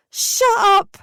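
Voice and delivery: very high, loud yell. A narrator's reading. Daughter yelling at her mum and brother on the phone, very high yell. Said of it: "I yelled back".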